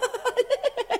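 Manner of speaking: high laughing burst; mocking giggle. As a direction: giggle at his face. Mocking his doubt about the cheque.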